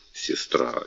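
тихий беззвучный шёпот; прошепчи имя; шёпотом. Acted. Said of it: "король подошёл к ней ближе". (шёпотом, почти без голоса)

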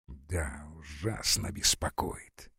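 confidential hushed reply. A narrator's reading. General admitting the bullet troubles him, hushed. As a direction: murmur it low, barely voiced. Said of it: "весело прошептал".

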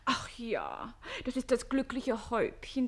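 usual speaking voice

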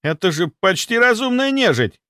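high, agitated captain's order; commanding. A narrator's reading. Captain demanding to see the creature, urgent command. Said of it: "приказал Стрельцов".

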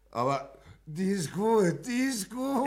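high and loud, laughing. Acted. Friend laughing loudly, calling the story good. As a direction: crack up while praising it.

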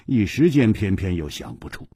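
usual speaking voice

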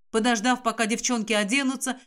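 ordinary delivery, nothing marked

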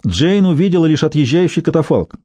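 plain reading voice

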